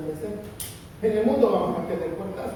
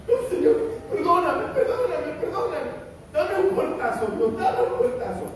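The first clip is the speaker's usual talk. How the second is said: higher, half-laughing mock plea to God; mock pleading prayer; 8 semitones higher than usual. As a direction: overdo the guilty believer begging. (creyente que le suplica a Dios, agudo y burlón)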